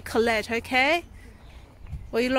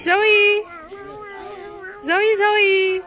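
calling out; 6 semitones higher, 3 dB louder, much slower than usual. Real, not acted. Calling the dog at the farm.

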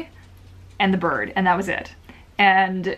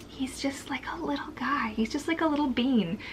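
fond whisper about her tiny dog; 5 semitones higher, 7 dB quieter than usual. Real, not acted. Owner whispering to viewers about her tiny dog, soft whisper. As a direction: breathe it out, no voice at all.

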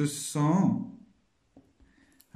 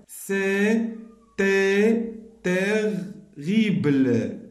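very slow, every syllable drawn out; slow syllabic reading; 4 semitones higher, much slower than usual. Shown as a demonstration. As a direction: stretch each syllable of C'était terrible. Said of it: "Je lis bien les syllabes".

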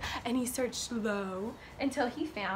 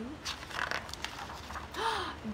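soft, drawn-in breath; hushed intake of surprise; anticipating gasp; 5 semitones higher, 14 dB quieter than usual. A narrator's reading. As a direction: gasp before the reveal. Anticipates the blueberry.

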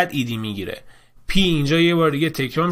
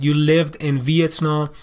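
slow dictation read; much slower than usual. Shown as a demonstration. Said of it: "reading answers slowly".

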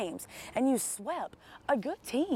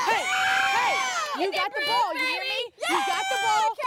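fired-up shout; 12 semitones higher than usual. Real, not acted. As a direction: pump the team up hard. Firing up the team.

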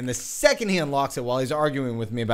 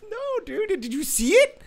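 put-on high-pitched, amazed drunk guy; imitating an excitable drunk. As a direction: mimic a drunk man awestruck by what he saw. Retelling drunk man's amazed questions.

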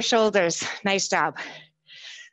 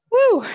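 loud high whoop of triumph; cheering woo; 13 semitones higher, 6 dB louder than usual. Real, not acted. Instructor cheering after a set.

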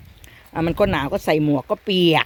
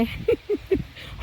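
high breathless giggle; 14 semitones higher, 5 dB quieter than usual. Real, not acted. ขำที่ออกเสียงคำว่าลูกเห็บไม่ได้.

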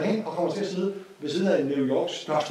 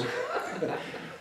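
soft laugh; 7 dB quieter than usual. Real, not acted. Laughs at his own joke.

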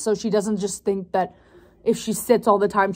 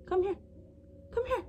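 soft high coaxing; 10 semitones higher, 13 dB quieter than usual. Real, not acted. Coaxing puppy softly.

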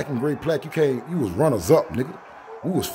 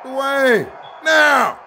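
loud, high excited yells; hyped shout; 13 semitones higher, 8 dB louder, much slower than usual. Real, not acted. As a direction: scream the names in excitement. Hyped calling out names.

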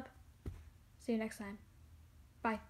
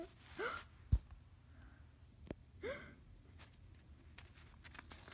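very high breathy sob-gasp; sobbing gasp; 13 semitones higher than usual. Acted. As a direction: gasp as if crying hard. Character sobbing.

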